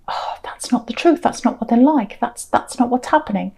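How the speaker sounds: hushed, breathy inner voice; whispered thought. Retold or imitated.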